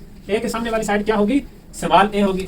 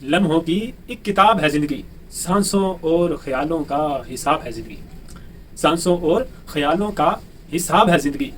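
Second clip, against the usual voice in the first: a teacher reciting a poem before class; poem recitation; 4 semitones lower than usual. Acted. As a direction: recite it like a couplet at a gathering.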